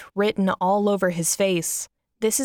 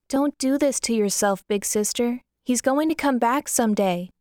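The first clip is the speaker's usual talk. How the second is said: put-on higher girlish voice, earnest; little sister; 3 semitones higher than usual. Little sister hugging Kyra, high hopeful voice. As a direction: pitch it up like a young girl. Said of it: "says Monica".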